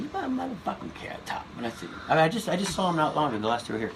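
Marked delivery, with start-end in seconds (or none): 0.0-1.5 a much higher, hammed-up comic's voice calling him out; mock Chris Rock, pitch way up, teasing; Chris Rock impression. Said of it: "he said".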